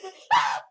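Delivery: short real laugh; quick amused laugh. Real, not acted. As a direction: burst out laughing. Laughing at own impression.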